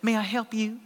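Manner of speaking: put-on sweet, soft Arkansas accent. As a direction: answer softly like a sweet Arkansas gal. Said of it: "with an Arkansas accent".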